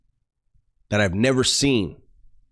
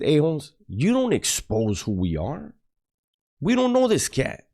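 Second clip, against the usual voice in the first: higher-pitched scolding, slower on the warning; retold stern rebuke; 6 semitones higher than usual. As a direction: lay down the rule, voice rising sharply. Scolding him for exposing them.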